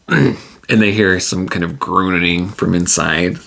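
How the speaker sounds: ordinary talk, nothing marked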